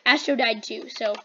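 usual speaking voice

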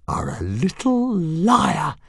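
high, slow, menacing dragon; hissed. A narrator's reading. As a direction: stretch out the accusation. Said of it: "hissed Fireworm".

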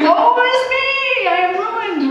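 very high, loud, slow wail of ruin; mock lament. Preacher echoing Isaiah for the congregation, hammed-up wail. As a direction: wail it, half joking.

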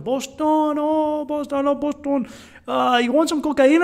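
high-pitched Colombian hustler impression; Colombian street hustler. Hustler offering cocaine to gringos, high imitated voice. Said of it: "we had a guy come up to us".

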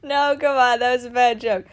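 ordinary talk

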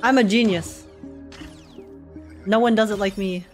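normal talking voice

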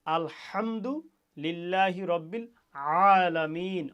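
slow, with the হা and আইন in আলামিন pressed in the throat; Arabic throat letters demo. Shown as a demonstration. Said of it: "গলায় চাপ দিয়ে উচ্চারণ".

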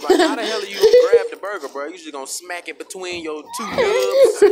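ordinary talk

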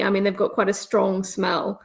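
normal talking voice

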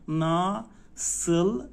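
very slow "ناسلسن" as na, sıl, sın; syllable-by-syllable practice. Shown as a demonstration. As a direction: separate each syllable of "ناسلسن", undotted ı. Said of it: "خب، باید تمرین بشه". («ناسلسن» هجا به هجا)

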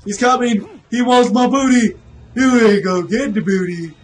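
high, loud mock panic; playful panicked yell. Gamer fleeing an attacker, playfully panicked. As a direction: yell as if being chased, hammed up. Enemy chasing the player.

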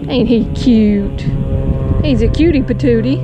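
doting coo over a tiny fish. Real, not acted. Angler cooing over a caught baby bass.

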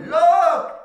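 full loud high shout; son's shout that stops the whole warehouse; top of his voice. Retold or imitated. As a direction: yell it across the warehouse. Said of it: "shouted at the top of his voice".

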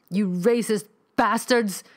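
loud, high, slow furious scream at his attackers. Acted. Neil fights off the cultists, screaming. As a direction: yell it with everything you have. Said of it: "he screamed".

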